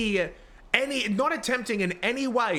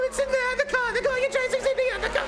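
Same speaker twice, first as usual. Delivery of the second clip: shrill, much higher mocking whine of the other officers; mocking the other cops; faster than usual. Streamer mocking his fellow cops' radio call, high squeaky mimicry. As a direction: parrot them in a squeaky, hammed-up voice. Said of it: "and they're like".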